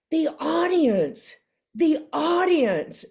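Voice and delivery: high, slow, marvelling repetition; awed director. Retold or imitated. Director backstage marvelling to the actress, high and slow. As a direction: draw it out in mock amazement.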